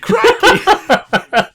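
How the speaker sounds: high, laughing Aussie exclamation; mock Australian "Crikey!". Joining the co-host's joke with a hammed-up Australian accent.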